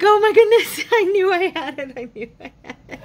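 high, loud laughing relief; excited squeal. Retold or imitated. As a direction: squeal with found-it joy. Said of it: "I was like".

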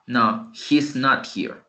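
slow, stressed "not"; slow second form. Shown as a demonstration. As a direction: land on "not" slowly. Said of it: "La otra forma". (otra forma, lenta, acento en "not")

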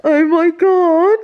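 slow, high, loud amazed "oh my God"; stunned by the gifts, loud and high; amazed gasp. Real, not acted. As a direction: draw it out loud and high in awe. Overwhelmed by surprise gifts.

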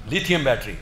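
usual speaking voice

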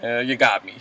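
low, soft, flat admission; low deadpan Huck. Retold or imitated. Huck admitting to Olivia, low and deadpan. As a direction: hand it over quietly, caught. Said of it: "And Huck was just like".

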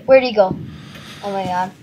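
ordinary talk

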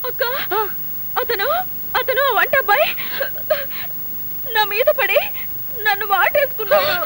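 tearful complaint. Acted. Younger sister tells elder one about the cook, tearful. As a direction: whimper it out between gasps.

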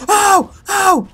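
two high, sharp cries; startled yelps. Real, not acted. Player caught in a fight, startled yelps. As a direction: yelp high at each hit.

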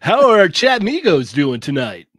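loud, high, hyped host voice; YouTuber voice. Acted. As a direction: pump it up like a streamer intro. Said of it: "the Lago YouTuber voice".

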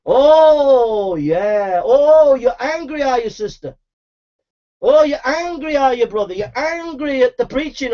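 usual speaking voice

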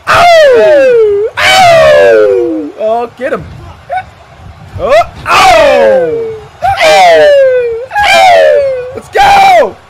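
loud, very high wince cries; pained cheering yells. Real, not acted. Fan watching brutal blows, loud wincing yells. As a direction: wince out loud at each hit.

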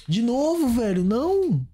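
fed up, this again, no!; exasperated outburst. Real, not acted. Same mistake happens again.

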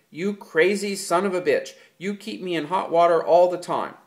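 loud, high near-shout; nearly a shout. A narrator's reading. George cursing Lenny, nearly shouting. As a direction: rise almost to shouting. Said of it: "His voice rose nearly to a shout".

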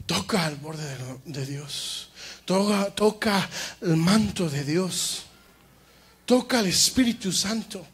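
soft invitation. Acted. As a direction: draw them in gently. Invita a tocar a Dios. (invitación suave y baja)

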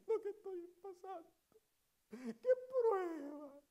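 preacher mocking a member who complains in tears; mock self-pitying complainer. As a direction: ham up a dramatic martyr moaning about his trials. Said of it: "en vez de estarte quejando".

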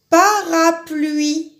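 "Parapluie" drawn out slowly, stressing "pluie"; teacher stretching out "Parapluie"; slow syllable reading. Shown as a demonstration. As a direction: say "Parapluie" slowly, leaning on the end. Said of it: "ça finit par I".

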